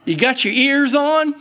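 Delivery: high, loud radio call-out. Retold or imitated. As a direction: hail Dad over the CB like a kid. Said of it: "I said".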